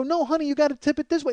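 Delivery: high-pitched, pressed correction; strained instructing. Retold or imitated. Husband telling wife how to tip dresser, strained. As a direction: correct her with strained patience.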